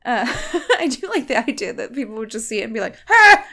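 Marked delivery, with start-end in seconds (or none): 3.1-3.5 short, high, loud startled yelp; brief comic scream of fright; mock scream. Imitating people seeing the monster.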